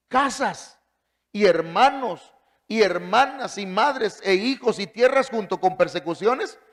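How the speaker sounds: high, loud preaching of the verse, slowing on the promise; fervent scripture reading. Acted. Preacher reading Mark to the congregation, high and loud. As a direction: proclaim the verse like a revival preacher.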